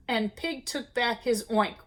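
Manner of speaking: usual speaking voice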